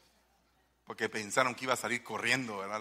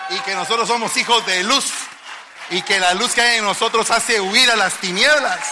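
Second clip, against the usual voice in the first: loud, raised preacher's shout; preaching at full force; 6 semitones higher, 12 dB louder than usual. Real, not acted. Preacher tells church they are children of light, loud proclamation. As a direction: proclaim it to the whole congregation, loud and high.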